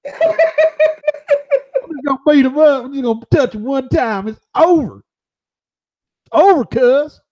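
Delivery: high Southern boxer voice, boasting; Butterbean impression. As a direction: brag like Butterbean about Wilder.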